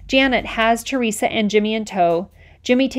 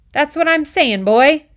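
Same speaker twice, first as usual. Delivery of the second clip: raised, higher insistent voice; louder; 7 semitones higher, 6 dB louder, slower than usual. A narrator's reading. Officer repeating himself louder to Moose. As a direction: push it up like he thinks the boy is stupid. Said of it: "He says this louder now".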